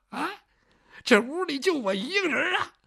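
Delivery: startled alarm. Acted. Alone at night he hears crying and blurts it out in alarm. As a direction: blurt it out, spooked.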